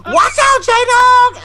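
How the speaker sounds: very high, urgent holler; imitated warning shout. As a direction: shriek a warning to a coworker. Coworker's warning repeated.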